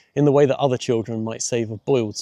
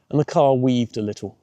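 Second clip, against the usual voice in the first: plain reading voice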